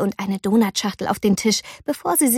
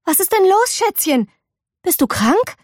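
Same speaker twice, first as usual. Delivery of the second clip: worried mother; 9 semitones higher than usual. A narrator's reading.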